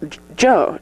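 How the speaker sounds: normal talking voice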